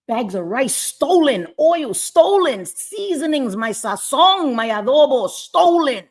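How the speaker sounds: rising into an angry shout, words stretched; heated outburst. Real, not acted. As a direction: let the anger boil over item by item. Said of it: "I got a little out of character".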